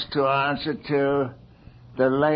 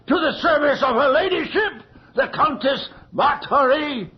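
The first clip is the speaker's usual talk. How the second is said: furious tirade; 7 semitones higher than usual. Acted. Magistrate rages at the girl for slandering the Countess. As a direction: thunder down on her.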